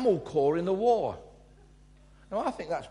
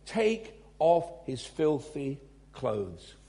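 slow command; 3 semitones lower, much slower than usual. Acted. Preacher quoting the angel's command.

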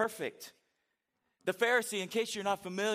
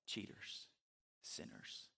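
whispered sneer; 6 semitones lower, 10 dB quieter, faster than usual. Acted. Mocking Pharisee list of sinners.

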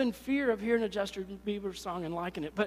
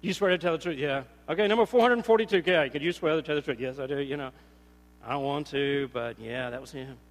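reluctant witnesses; 8 semitones lower, 4 dB louder than usual. Retold or imitated. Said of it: "how many witnesses".

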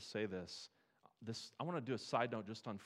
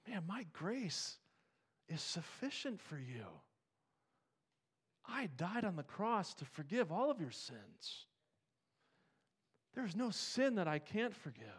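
higher, tender voice of a loving Father; reassuring God; 8 semitones higher than usual. Acted. God speaking to a guilty believer: higher and tender. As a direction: speak as God comforting his child.

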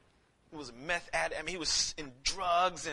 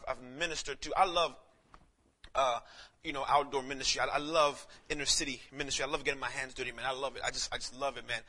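ordinary talk, nothing marked

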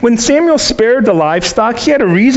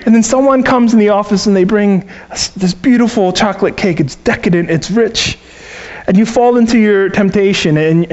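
usual speaking voice

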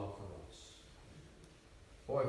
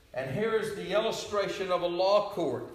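high, loud courtroom preaching; preaching at full force; 9 semitones higher, 9 dB louder than usual. Real, not acted. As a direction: proclaim the verdict with power.

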